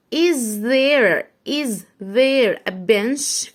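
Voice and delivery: very slow "is there a bench"; slow read. Shown as a demonstration. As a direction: read it slowly with a rise. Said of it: "reading slowly".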